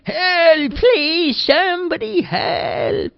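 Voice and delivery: high, pleading cries from a cave; high trapped old man crying for help; desperate cry for help. A narrator's reading. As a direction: call out for rescue, drawn out. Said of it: "the voice was getting louder".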